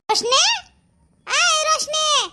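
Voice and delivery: drawn-out high shout for the daughter; calling out. Acted. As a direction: yell her name slowly across the yard.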